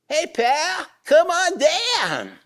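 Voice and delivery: high, loud gruff cop call, drawn out slowly; Baltimore cop. Retold or imitated. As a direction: play a Baltimore cop shouting up the ladder. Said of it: "That's the way Goyim talk in Baltimore".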